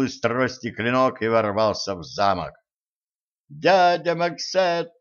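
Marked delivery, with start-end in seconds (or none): 3.5-4.9 high, slow, mild squeal. A narrator's reading. Zhenka watches him jump, squealing his name. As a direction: cry out the name in a raised voice. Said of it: "взвизгнула Женька".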